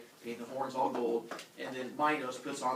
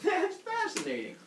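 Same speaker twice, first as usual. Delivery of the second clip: sarcastic. Acted. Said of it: "He was like".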